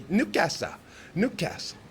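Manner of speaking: mock Geordie pronunciation of Newcastle; Geordie accent. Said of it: "one of the funniest ones".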